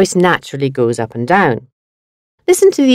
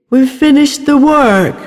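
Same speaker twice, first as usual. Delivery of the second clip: excited; 8 semitones higher than usual. Shown as a demonstration. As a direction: sound thrilled it's done. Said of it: "according to the speaker's tone of voice".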